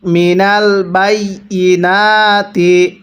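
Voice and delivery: slow, drawn-out tajweed chanting; slow Quran recitation. Shown as a demonstration. Teacher demonstrating recitation to students, slow chant. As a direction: recite each syllable long and measured. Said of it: "কিভাবে পড়তে হবে?".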